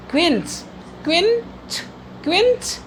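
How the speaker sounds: क्विंच drilled as "kvin-ch", later takes rising high; model pronunciation. Shown as a demonstration. Teacher shows students how to read it, model pronunciation. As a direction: sound out क्विंच as "kvin-ch", lifting the pitch on repeats. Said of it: "क्विंच पढ़ेंगे".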